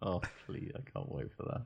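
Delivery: near-whispered, voiceless longing; hushed eager plea. Real, not acted. Co-host relishing the coming pasting, hushed eager plea. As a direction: drop right down to a whispered plea.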